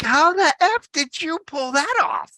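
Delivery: high, amazed and incredulous; amazed disbelief. Retold or imitated. Adam to his friend, high and amazed. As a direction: marvel at the pull-off.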